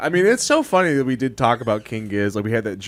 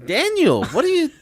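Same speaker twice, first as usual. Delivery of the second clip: much higher whiny pleading bandmate; 4 dB louder than usual. Pretend. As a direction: plead in a high put-on voice. Band pleading, high put-on voice.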